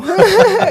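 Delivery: a burst of loud, delighted laughter. Real, not acted. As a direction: laugh out loud, caught off guard. Surprised, reacting to strong remark.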